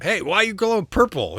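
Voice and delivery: high, loud, incredulous demand; startled question. Acted. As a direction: call out in baffled alarm. Acted startled creature among bickerers.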